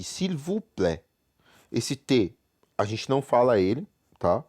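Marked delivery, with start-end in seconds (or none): 0.0-1.0 s'il vous plaît slowly, plaît without t; French pronunciation. Shown as a demonstration. Teacher showing silent final T, careful French pronunciation. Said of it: "o T no final do plaît".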